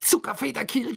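very high exasperated cry; angry yell. A narrator's reading. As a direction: yell a guess in pain and temper. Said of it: "schrie er zornig auf einem Bein hüpfend".

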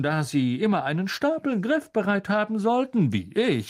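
swooping, theatrical, self-satisfied lecture with a high chuckle; pompous celebrity mentor. Acted. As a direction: advise him condescendingly, savouring every phrase.

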